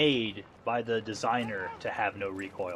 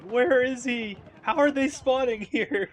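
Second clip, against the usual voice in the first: confused enemy; 13 semitones higher, 7 dB louder, slower than usual. Retold or imitated. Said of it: "I bet they're like".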